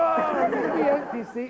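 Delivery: ordinary delivery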